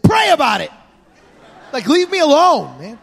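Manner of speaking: annoyed dad. Retold or imitated. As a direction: snap it off half-laughing. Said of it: "I said".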